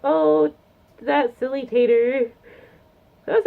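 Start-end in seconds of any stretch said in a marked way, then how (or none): 0.0-2.3 a light teasing voice, a bit louder and higher; lightly voiced artist chuckling at a fan; fond teasing. An admired artist teasing her fan, lightly voiced. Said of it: "she's probably like".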